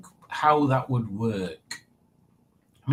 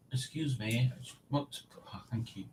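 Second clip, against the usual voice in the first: aside whisper; 14 dB quieter than usual. Real, not acted. Aside off mic.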